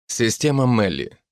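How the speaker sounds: usual speaking voice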